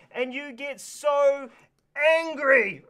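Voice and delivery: slowed build to a loud mock shout on angry; mock anger. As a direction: stretch it out, then bark angry, playfully. Describing getting angry.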